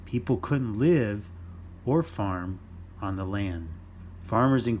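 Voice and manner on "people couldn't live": ordinary delivery, nothing marked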